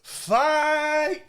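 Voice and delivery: loud, high shout of "Fight!"; a sudden high yell; yelled fight call. Retold or imitated. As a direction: bellow it to start the brawl. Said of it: "he goes".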